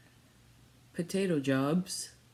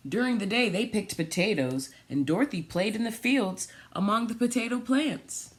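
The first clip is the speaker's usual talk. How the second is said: storybook reading; 4 semitones higher than usual. Acted. Reads picture book aloud.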